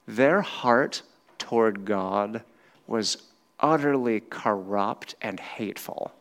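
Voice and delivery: normal talking voice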